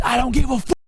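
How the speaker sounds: high-pitched mock holler; imitated yelling. Buddy yelling back at an angry mom, imitated high yell. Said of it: "He starts yelling at her".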